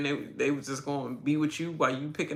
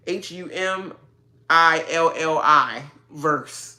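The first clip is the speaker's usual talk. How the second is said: slow spelling; 7 dB louder, slower than usual. Shown as a demonstration. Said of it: "How do I spell this Humiliverse".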